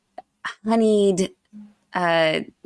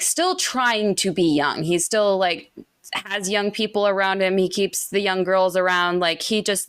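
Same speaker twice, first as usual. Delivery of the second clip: usual speaking voice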